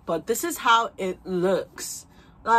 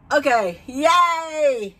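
slow excited yay; 7 semitones higher, 7 dB louder, much slower than usual. Real, not acted. Wig finally goes on.

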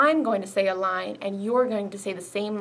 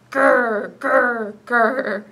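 louder playful growls; bear growl; 7 dB louder, much slower than usual. Retold or imitated. Librarian growling like a bear for the kids. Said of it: "A bear is what I found".

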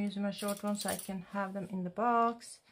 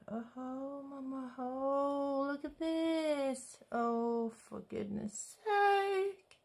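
awed sigh; 5 semitones higher, much slower than usual. Real, not acted. Swooning over nail gel color.

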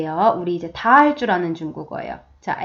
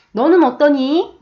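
higher, slow kindly asking; smiling question; 6 semitones higher, 4 dB louder than usual. Acted. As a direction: ask gently with a smile. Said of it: "웃으면서". (웃으며 다정하게 묻는 선생님)

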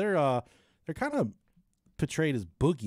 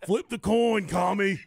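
mock Southern accent; 6 semitones higher, 6 dB louder, slower than usual. Continuing the mock Southern bit.

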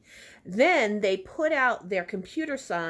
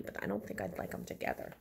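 quiet aside; 10 dB quieter than usual. Real, not acted. Aside on sandwich.

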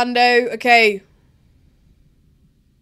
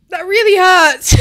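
overwhelmed; 8 semitones higher, 9 dB louder, slower than usual. Real, not acted. Tense finish, emotional.

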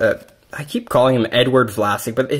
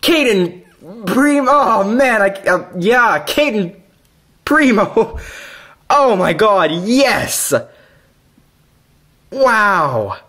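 high, thrilled cheers at a big pull; excited cheering; 7 semitones higher, slower than usual. Real, not acted. Collector opening a pack, thrilled at his pull. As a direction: cheer it out like you just hit the card. Pulls a hoped-for card.

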